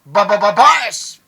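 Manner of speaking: booming, drawn-out stuttered yell; stuttered hype shout. Real, not acted. Hype shout after rap punchline. (pautal-utal na malakas na sigaw)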